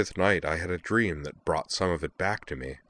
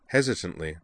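usual speaking voice

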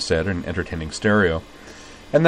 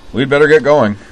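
hearty urging; 7 semitones higher, 9 dB louder than usual. Acted. Corwin urging departure.

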